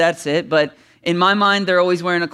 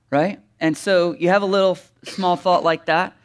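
ordinary delivery

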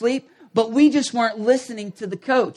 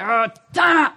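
shouted, higher, a coach calling a timeout; mock coach shout; 4 semitones higher, 3 dB louder than usual. Coach to losing team, a shouted timeout call. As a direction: bark out the timeout like the worked-up coach. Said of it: "and he's like".

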